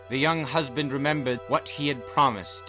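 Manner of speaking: usual speaking voice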